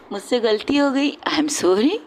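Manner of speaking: ordinary talk, nothing marked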